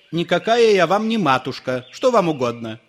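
wife rejecting the priest's flattery; curt rebuff. A narrator's reading. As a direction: cut him off.

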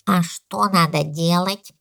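slow, drawn-out question; baby elephant voice. A narrator's reading. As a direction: ask slowly as an innocent baby elephant. (голос слонёнка, медленно)

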